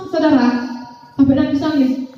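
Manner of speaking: usual speaking voice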